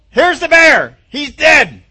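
very high, loud proud reveal; triumphant shout. Acted. David shows off the dead bear, triumphant shout. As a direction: shout the proof to the doubters.

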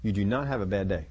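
low, soft, steady affirmation to yourself; mirror self-talk. Pretend. Preacher voicing a believer telling their mirror self, low and soft. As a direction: murmur the vow low and even into the mirror. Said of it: "look yourself in the mirror and say".

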